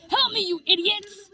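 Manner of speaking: high-pitched, desperate cry for help; pained yell. A narrator's reading. Bitten Dandy yells at his friends for help. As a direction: cry out high, no real volume. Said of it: "he yelled at us".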